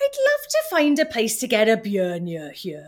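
sing-song Welsh Valleys model line; Welsh Valleys accent. Shown as a demonstration. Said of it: "Go, try again".

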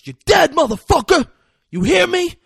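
loud, very high enraged yelling; acted furious threat. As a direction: yell threats after being punched. Punched man threatens, retold.

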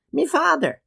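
put-on very high, little child's voice; child's answer. Child answering the gruff uncle, high piping voice. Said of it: "came the answer".

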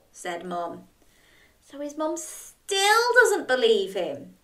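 plain reading voice

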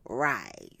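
breathless whispered aside. Real, not acted. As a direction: drop to a whisper. Aside after laugh.

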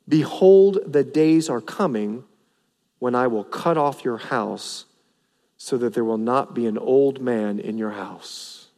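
God warning Eli's house, hushed and grave; solemn divine warning. Acted. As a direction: intone it gravely. Quoting the Lord's warning.

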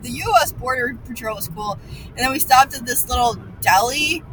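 normal talking voice

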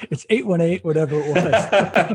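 laughing through the words; chuckling as the story lands. Real, not acted. Telling Simon about the phone number, laughing. Laughing while retelling the joke.

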